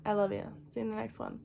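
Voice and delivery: ordinary delivery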